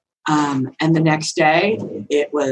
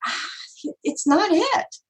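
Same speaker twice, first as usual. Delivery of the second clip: doubtful no; 12 semitones higher than usual. Retold or imitated. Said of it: "I was like".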